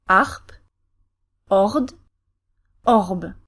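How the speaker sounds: "arp, ord, orb", each apart with French R before the consonant; French R drill syllables. Shown as a demonstration. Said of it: "then you add your R, then a consonant".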